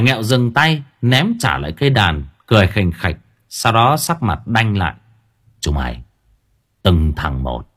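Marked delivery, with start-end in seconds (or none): 5.6-7.7 gangster voice. Acted. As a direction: speak like a street mob boss. Gangster voice again. (giọng xã hội đen trầm, đe dọa)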